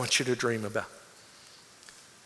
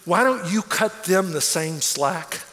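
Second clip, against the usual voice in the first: normal talking voice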